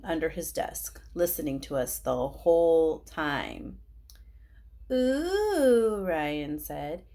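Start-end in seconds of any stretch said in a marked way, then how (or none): 4.9-6.0 high, loud singsong ooh; teasing ooh. Acted. Classmate teasing two kids about love, singsong ooh. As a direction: tease them with a drawn-out ooh.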